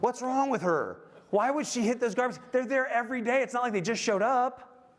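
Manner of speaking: mock exasperated griping; mock indignant rant. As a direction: play the wronged husband, hammed-up. Voicing his irritated thoughts.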